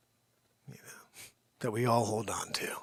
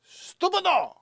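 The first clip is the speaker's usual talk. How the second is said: much higher, louder hammed-up Italian yell; mock Italian; 6 dB louder, slower than usual. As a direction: scold the dog in fake Italian. Mock Italian scolding dog.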